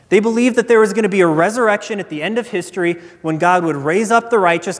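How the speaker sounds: normal talking voice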